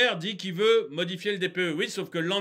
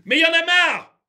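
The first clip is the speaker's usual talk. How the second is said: loud, high exasperation; worked up; 7 semitones higher, 8 dB louder than usual. Real, not acted. Ranter interrupts his own report, fed-up outburst. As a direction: burst out, fed up. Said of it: "je m'énerve".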